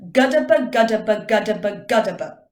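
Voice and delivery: rapid-fire GUDDABE, loud and rhythmic; fast, punchy articulation drill; quick repetition. Shown as a demonstration. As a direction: rattle it off, stepping down in pitch. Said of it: "do it four times again quickly".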